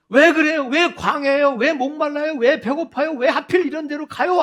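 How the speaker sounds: high, loud barrage of whys; complaining and blaming. Acted. Israelites pestering God with grievances, loud complaining. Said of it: "끊임없이 하나님께 대해서 불평하고 원망하고".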